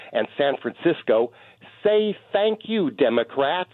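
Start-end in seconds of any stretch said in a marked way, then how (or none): 1.8-3.7 mocking demand. Real, not acted. Caller taunting Democrats.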